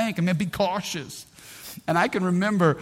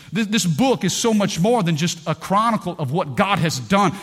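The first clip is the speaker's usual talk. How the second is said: normal talking voice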